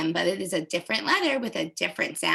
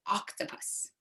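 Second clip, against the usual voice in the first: "octopus" whispered much softer; whispered word; 11 dB quieter than usual. Shown as a demonstration. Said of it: "Say it with me".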